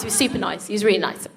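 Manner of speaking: ordinary talk, nothing marked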